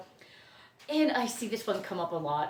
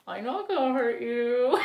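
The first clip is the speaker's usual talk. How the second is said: parrot impression. Parrot reassuring its owner before attacking, in a put-on bird voice. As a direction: mimic a parrot stretching out its words. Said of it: "he just says it that voice".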